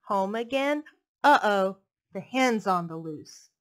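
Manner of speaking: put-on lilting read-aloud voice for little kids; storybook reading. Reading a picture book to young children, lilting storytelling. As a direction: read it like bedtime story time.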